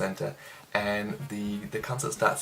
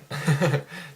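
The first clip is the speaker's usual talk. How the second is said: short laugh; 5 semitones higher, 3 dB louder than usual. Real, not acted. Laughs after beer joke.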